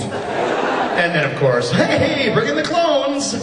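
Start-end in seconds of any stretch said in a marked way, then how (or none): 1.7-3.4 high, drawn-out gleeful call; mock showman cheer. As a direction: announce it like a cheery showman, pitched way up. Mimics gleeful revival of the character.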